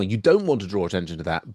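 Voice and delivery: ordinary delivery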